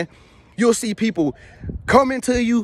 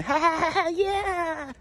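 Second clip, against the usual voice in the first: high mock group laugh; party guests suddenly laughing along; fake high laughter; 9 semitones higher, 6 dB quieter, slower than usual. Everybody laughing.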